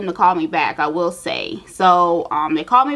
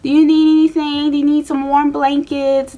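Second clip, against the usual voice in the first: higher, gentle nurse reassuring a scared patient; soothing nurse; 6 semitones higher than usual. Retold or imitated. Nurse calming the shaking patient before surgery, soothing and high. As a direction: coax her to calm down. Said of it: "She was like".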